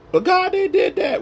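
high, protesting whine to God; whiny believer. Retold or imitated. Believer whining up at God about others. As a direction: plead your case like a sulky child.

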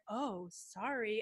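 1 imitating his sheepish sorry. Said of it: "he's like".

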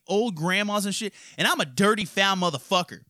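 usual speaking voice

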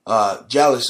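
normal talking voice

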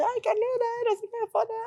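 high, thrilled fan squeal; excited fans calling. Retold or imitated. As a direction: call her name like an eager fan. Fans call out to her. (fan emocionada gritando agudo)

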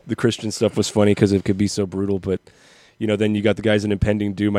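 ordinary delivery

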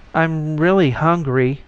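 lightly voiced hungry beggar; muttered plea. A narrator's reading. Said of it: "the intricately arranged atoms muttered".

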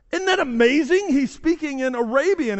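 high-pitched amazed remark; amazed bystander. Acted. Arabian man tells the Cretan what he hears, amazed.

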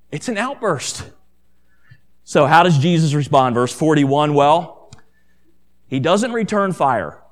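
normal talking voice

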